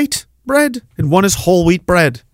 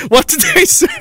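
high-pitched, breaking into laughter; laughing. Real, not acted. Asking what else they call him, laughing. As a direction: squeal it out through real laughter. Cracks up over the name list.

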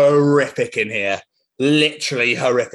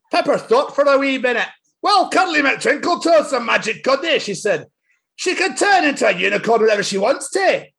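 higher Scottish storybook reading; 9 semitones higher than usual. A narrator's reading. Reading Peppa book to friend in Scottish accent. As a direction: read the story in a broad Scots lilt. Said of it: "I'm gonna do it in my accent".

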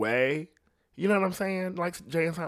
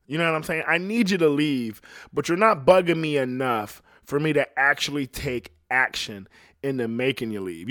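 ordinary talk

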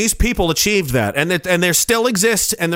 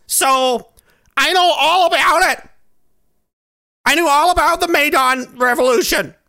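mock know-it-all rant; 6 semitones higher than usual. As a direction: parody a smug armchair expert. Mocking naive know-it-all rant.

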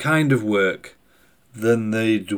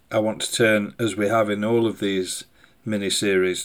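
ordinary talk, nothing marked

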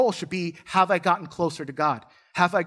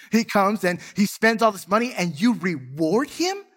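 higher, louder indignant outburst; angry protest; 5 semitones higher, 3 dB louder than usual. Retold or imitated. Elder brother shouting at his father, indignant. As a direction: let the resentment spill out.

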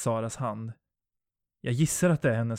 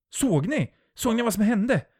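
high, loud, urgent questions; panicked question; 11 semitones higher, 6 dB louder than usual. A narrator's reading. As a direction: cry out in shock to the others. Shocked witness calls to friends. (uppskärrat rop)

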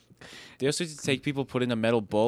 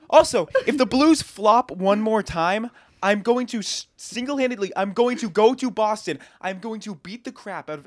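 loud, higher fan outburst; mad rant; 6 semitones higher, 5 dB louder than usual. Real, not acted. Hockey fan threatening the Blues over flopping, loud angry rant. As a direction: rail at the team, hitting it hard. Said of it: "I'm gonna be so mad".

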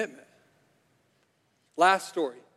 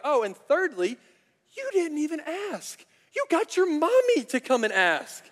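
much higher, sneering mock voice; mocking outrage; 10 semitones higher, 3 dB louder than usual. Disciples mocking James and John for sending their mother, high sneer. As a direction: needle them like a sarcastic sibling. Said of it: "they're furious".

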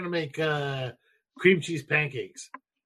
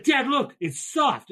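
put-on excited child; 10 semitones higher than usual. Daughter showing a baby chick.

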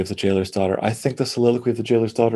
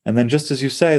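ordinary delivery

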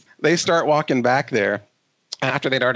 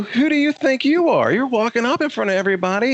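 high-pitched acted challenge; retold indignant retort; 10 semitones higher than usual. Man confronting line cutters in front of everybody, indignant retort. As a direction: fire it back, pitched well up.

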